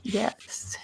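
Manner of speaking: choked whisper. Real, not acted. Tearful reply after the messages.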